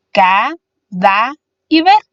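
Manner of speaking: "Ca, da y ver" slowly, a pause after each syllable. Shown as a demonstration. Teacher dividing cadáver for learners, "Ca, da y ver" syllable by syllable. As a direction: split "Ca, da y ver" into separate drawn-out syllables. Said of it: "se divide en tres sílabas".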